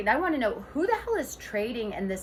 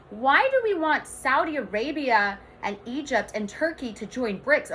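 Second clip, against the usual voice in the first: normal talking voice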